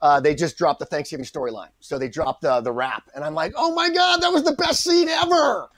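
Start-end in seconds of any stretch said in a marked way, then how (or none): 3.6-5.7 very high, wailing protest; mock outrage. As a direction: whine in hammed-up dismay, pitched way up. Mock anguish at cut scene.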